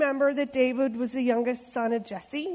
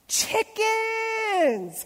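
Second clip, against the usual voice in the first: much higher mocking giant bellow; 11 semitones higher than usual. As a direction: jeer at them like a bully giant. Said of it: "Goliath bellowed".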